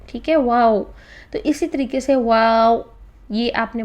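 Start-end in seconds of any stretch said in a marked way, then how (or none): 0.3-0.8 wao with rounded lips only; واو with a w sound, not v; English word wow. Shown as a demonstration. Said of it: "جیسے کہ آپ English کا word بولتے ہیں واو".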